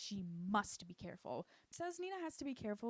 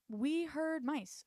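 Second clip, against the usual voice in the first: slow, high, louder fake-innocent excuse; 6 semitones higher, 7 dB louder, much slower than usual. [name] lying to Victor in a slow fake-innocent voice. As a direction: draw it out like an obvious lie.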